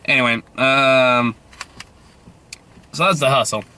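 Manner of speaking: normal talking voice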